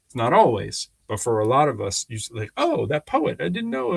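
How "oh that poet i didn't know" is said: high-pitched mock surprise; light, high retold exclamation; surprised onlooker. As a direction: imitate someone just finding out, pitched way up. Voicing a surprised onlooker's reaction.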